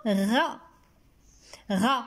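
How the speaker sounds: isolated syllables with long gaps; slow, separated teaching syllables. Shown as a demonstration. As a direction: sound out غزال piece by piece as if writing it. Writes word syllable by syllable.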